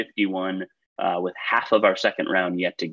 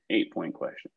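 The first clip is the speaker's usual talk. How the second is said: softer, lower echo to himself; quiet repeat; 4 semitones lower, 9 dB quieter than usual. Shown as a demonstration. Quiz host repeating the question to himself, much softer. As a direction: murmur it back under your breath. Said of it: "host repeats it softly".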